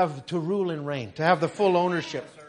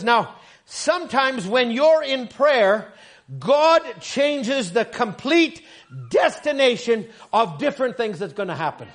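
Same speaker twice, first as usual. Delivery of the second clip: slow, raised, weighty read; sermon reading; 3 semitones higher, 4 dB louder, slower than usual. Acted. Preacher reads the quote to the church, slow and weighty. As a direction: lean on every word slowly.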